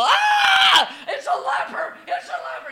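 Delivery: mock panicked crowd cry; startled yell of the crowd; crowd shouting. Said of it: "I can imagine the people".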